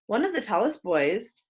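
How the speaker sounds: ordinary delivery, nothing marked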